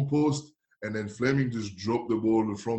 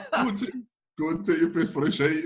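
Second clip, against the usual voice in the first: hammed-up Glaswegian banter; Scottish slang; 11 semitones higher than usual. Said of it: "And I'm like".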